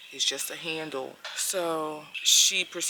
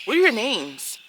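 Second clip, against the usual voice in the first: loud, higher "What are your names?"; imitated hostile neighbor, loud; aggressive demand; 6 semitones higher, 10 dB louder than usual. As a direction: demand it like an interrogation. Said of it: "That is also aggressive".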